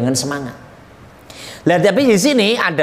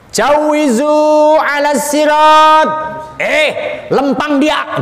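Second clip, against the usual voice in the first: high, loud acted bark; angel snapping orders; 8 semitones higher, 3 dB louder, slower than usual. Angel orders people across the bridge, acted shouting. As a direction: shout it as a stern command. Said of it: "malaikatnya bentak".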